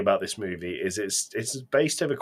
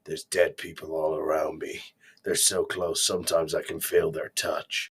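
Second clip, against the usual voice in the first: mock brooding narration; 7 semitones lower than usual. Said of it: "Mark Wahlberg in the water going".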